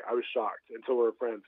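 normal talking voice

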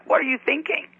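mock-scold them in disbelief; exasperated mother. Mother to drinking kids, exasperated.

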